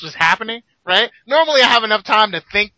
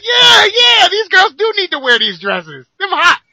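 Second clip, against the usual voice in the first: mock enthusiasm; 10 semitones higher, 4 dB louder than usual. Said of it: "I was like".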